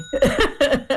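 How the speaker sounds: a quick laugh at herself; real short laugh. Real, not acted. Guest laughing after naming her family's roots. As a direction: laugh briefly, caught out.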